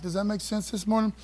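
usual speaking voice

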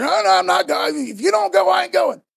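loud, high, agitated refusal; all worked up. Acted. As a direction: dig in your heels, worked up. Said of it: "all worked up".

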